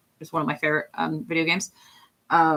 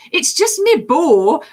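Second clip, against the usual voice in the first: high, loud, drawn-out Scottish accent; 11 semitones higher, 8 dB louder, slower than usual. Shown as a demonstration. Streamer showing viewers an accent anchor, loud high Scottish. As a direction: belt it out slowly in broad Scottish. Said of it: "when I do Scottish, I'm always like".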